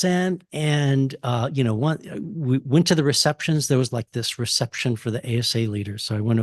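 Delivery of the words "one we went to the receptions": ordinary delivery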